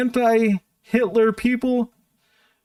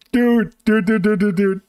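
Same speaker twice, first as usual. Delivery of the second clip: louder burst of dude, dude; excited repeated dude; 2 semitones higher, 5 dB louder than usual. Real, not acted. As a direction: call out excitedly, rapid-fire. Excited reaction to clip.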